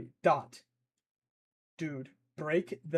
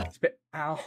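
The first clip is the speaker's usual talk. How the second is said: ordinary delivery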